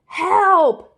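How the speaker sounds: loud, high yell for help; cry for help. A narrator's reading. The trapped sound crying out loud and high. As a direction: call out desperately from below. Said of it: "cried the sound".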